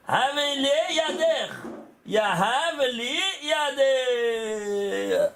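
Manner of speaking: slow, drawn-out Hebrew intoning; slow recitation. Shown as a demonstration. Teacher intoning the phrase slowly for the students. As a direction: stretch each syllable like a chant. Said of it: "c'est quoi?".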